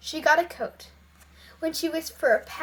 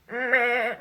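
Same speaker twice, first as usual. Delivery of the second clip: goat bleat; 3 semitones lower than usual. Acted. Voices the goat's bleat.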